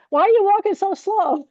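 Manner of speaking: high, amused mock teacher voice; imitating the teacher. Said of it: "he'd come up in the middle of your walking and go".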